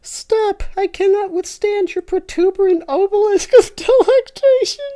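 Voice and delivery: loud, high pleading falsetto; high falsetto scream. Acted. Tinky Winky rereading his scream, loud falsetto. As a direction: wail it even shriller. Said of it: "Tinky Winky screams".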